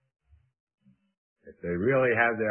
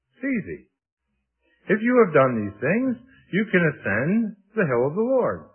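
high, lilting mock assurance; mock breezy; 10 semitones higher, 5 dB louder than usual. Preacher teasing that anyone can ascend the hill, mock breezy. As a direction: toss it off in a much higher pitch, as if it were simple. Mock-easy claim about impossible standard.

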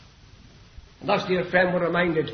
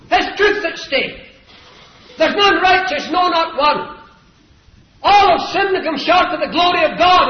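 high, impassioned gospel preaching; 11 semitones higher, 10 dB louder than usual. Real, not acted. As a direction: drive the message home from the pulpit. Preaches at full force.

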